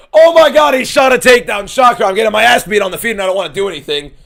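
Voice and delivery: high, loud, exasperated yelling; outraged shout. Real, not acted. Streamer yelling at the takedown, high and loud. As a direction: shout in disbelief at the fight. Fight reaction, opponent shoots takedown.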